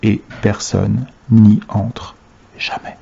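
slow, low hush, fading to almost nothing; hushed, measured confidence; confidential whisper. A narrator's reading. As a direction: let the secret die away on the last word. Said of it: "baissa la voix pour chuchoter confidentiellement".

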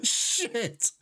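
high cheer into a laugh; victory shout. Real, not acted. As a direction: scream with relief and laugh. Boss finally defeated.

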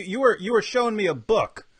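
ordinary talk, nothing marked

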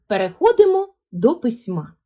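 ordinary talk, nothing marked

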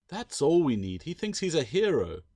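high, loud exasperated complaint; complaining. A narrator's reading. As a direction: complain with mock despair. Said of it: "Claire complained".

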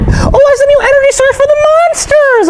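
soaring high cartoon squeal; excited revelation. Mouse spinning a conspiracy theory, high and excited. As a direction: squeal it as a big discovery.

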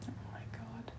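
breathless whispered despair. Real, not acted. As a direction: barely breathe it out. Overwhelmed by the game.

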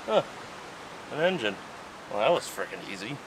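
normal talking voice

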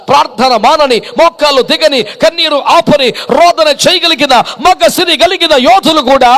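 loud, driving sermon cadence; loud emphatic preacher's list; full-force preaching. Real, not acted. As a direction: belt out each phrase to the congregation.

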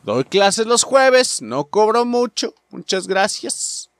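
drawn-out playful ad pitch; hammed-up teacher promo; mock sales pitch. Jokes about giving shooting lessons.

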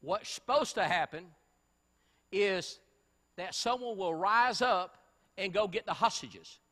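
loud, urgent preacher's call; rally the congregation to take action; preaching at full force. Acted.

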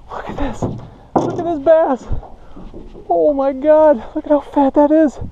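high-pitched, amazed showing-off; thrilled. Real, not acted. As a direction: marvel high at the huge catch. Showing off the huge bass.

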